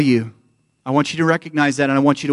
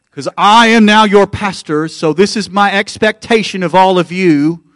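loud, higher mock American pastor; put-on American accent; 5 semitones higher, 6 dB louder than usual. Pastor imagining demands of his church, mock American accent. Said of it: "I went into an American accent".

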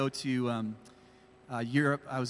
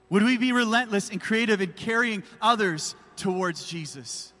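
the same question again, pushed louder; louder repeat; 6 semitones higher, 5 dB louder than usual. Acted. Pastor pressing his congregation, louder insistent repeat. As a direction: raise the stakes and lean into it.

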